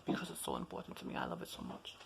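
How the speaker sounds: whispered, dropping to nothing; whispering. Real, not acted. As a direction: breathe it as a secret. Fading to whispered confession.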